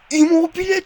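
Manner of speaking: rising excited shout; excited yell. Real, not acted. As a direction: jump on the name as the shot goes in. Big attacking chance in game.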